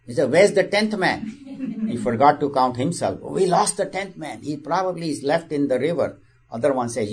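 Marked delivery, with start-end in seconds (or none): 0.3-1.9 high, slow, alarmed question; alarmed cry. Acted. Disciple finds one man missing. 3.2-6.2 a touch of higher, worried wailing; mock panic. Disciples panic over lost man.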